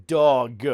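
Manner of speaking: "dog" drawn out and punched; over-enunciate "dog" to correct a mishearing; emphatic correction. Real, not acted. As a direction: say "dog" loudly with a heavy final g.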